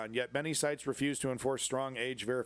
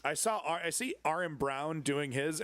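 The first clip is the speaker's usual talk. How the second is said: ordinary talk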